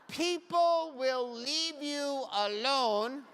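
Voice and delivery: very slow, stretched-out words; drawn-out gag delivery. Pretend. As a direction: draw out every word in a put-on voice. Demonstrating the gag glasses to audience.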